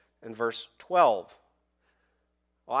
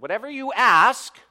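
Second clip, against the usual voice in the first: loud, high, ringing declaration; forceful scripture proclamation; powerful; 8 semitones higher, 8 dB louder, slower than usual. Acted. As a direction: proclaim it slowly, phrase by phrase. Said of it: "He makes this powerful statement".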